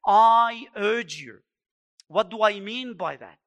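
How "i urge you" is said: loud, slow "I urge you"; front-load "I" with force, falling on "you"; emphatic exhortation. Acted. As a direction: hit "I" hard and stretch "I urge you". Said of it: "front loads his exhortation with this phrase".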